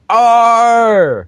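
big pirate-like growling yell; comic-book roar. Acted. Reading out which character roars in the comic, loud mock roar. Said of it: "is it King Kong or is it Ann that's going".

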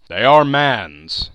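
slow, loud ghostly pronouncement; echoing ethereal energy being. A narrator's reading. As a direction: intone it like a solemn spirit. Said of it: "said the spirit".

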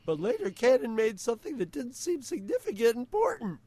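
high, loud put-on teen voice; squeaky teen boy impression; Patrick Delmore voice. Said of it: "a hard sentence to say in that voice".

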